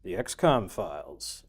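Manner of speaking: slow, drawn-out announcer greeting; show host intro. Acted. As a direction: welcome them like a TV host. Streamer's drawn-out show opener.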